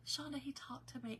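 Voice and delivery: soft, breath-only retold news; choked-up whisper. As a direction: whisper it as if overcome by tears. Retelling father-in-law's tearful news.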